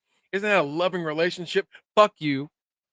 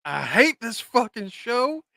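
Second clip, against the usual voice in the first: slow, higher mock-despairing quote of Kenny; quoting Kenny; 7 semitones higher, 4 dB louder, slower than usual. Quoting Kenny's reaction to the episode, slow mock despair. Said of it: "quote Kenny".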